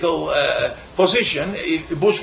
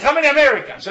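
mad tour guide asking; 9 semitones higher, faster than usual. Retold or imitated. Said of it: "And, and, and he was mad".

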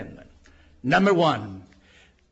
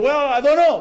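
mock flustered young man; unsure caller, higher and louder; 8 semitones higher, 6 dB louder than usual. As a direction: caught out, pitched up.

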